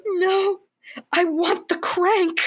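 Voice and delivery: put-on high, drawn-out childish-style insistence; hammed-up stubborn refusal; mock stubborn demand. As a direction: dig in with a high, slow mock whine. Acts out her stubborn refusal.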